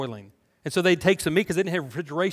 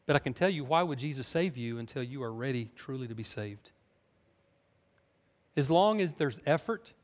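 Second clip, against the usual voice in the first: ordinary talk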